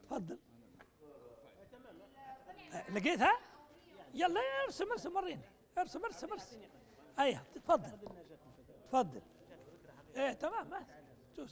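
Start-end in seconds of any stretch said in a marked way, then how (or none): 4.1-6.6 higher-pitched, quick "go on, draw"; egging them on to draw; eager urging. Real, not acted. Lecturer excitedly pushing a participant to draw the answer.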